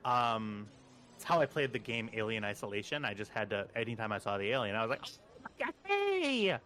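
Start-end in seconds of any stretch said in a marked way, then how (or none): 5.0-6.6 very high squeaky greeting; thin falsetto, mock-friendly hi; nervous fake friendliness. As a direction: squeal a hello at the monster. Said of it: "As I'm sort of creeping around".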